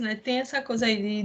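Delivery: ordinary talk, nothing marked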